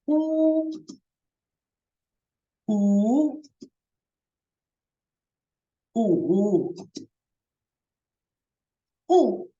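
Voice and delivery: u through first, second, third and fourth tones. Shown as a demonstration. Teacher drilling the four tones on u. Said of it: "Then it's".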